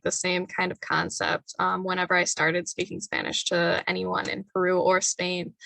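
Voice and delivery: usual speaking voice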